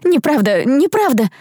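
high, repeated protest; upset denial. A narrator's reading. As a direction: insist with rising pitch on each repeat. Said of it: "замотала я головой". (взволнованно, высоким голосом)

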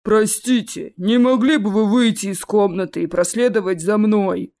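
politely formal troll voice; growling courteous bass. A narrator's reading. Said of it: "Любезно поинтересовался кто-то рычным басом". (любезный рычащий бас)

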